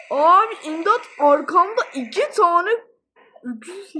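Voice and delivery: tiz ve yüksek sesle yardım iste; peşindeki ayıdan kaçarken yardım çağır; imdat çığlığı. Real, not acted. Bear chasing, calls for help. (panikle yardım isteyen bağırış)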